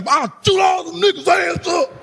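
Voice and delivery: high, shouted hammed-up threat; a hammed-up shouted boast, showing off; mock tough-guy brag. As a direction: puff up like a fake gangster bragging. Mocking tough talk before girl.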